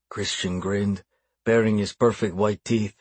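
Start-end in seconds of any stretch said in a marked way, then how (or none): none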